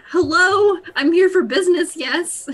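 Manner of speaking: high, loud mock greeting; hammed-up eager arrival; eager early customer. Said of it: "you're like".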